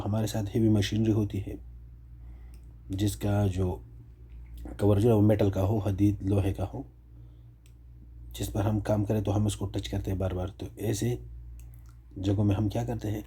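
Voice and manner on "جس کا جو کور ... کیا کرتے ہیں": normal talking voice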